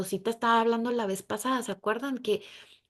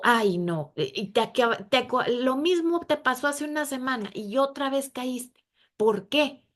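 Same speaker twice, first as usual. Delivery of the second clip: higher, louder, fed-up complaint; exasperated scolding; 5 semitones higher, 4 dB louder than usual. Pretend. Imagined God fed up with the same mistake, loud and high. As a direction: sigh and scold, out of patience.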